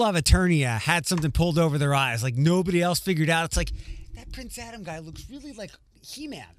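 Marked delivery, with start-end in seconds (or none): 4.1-6.5 mock dim bystander. Mock dumb citizen wondering aloud.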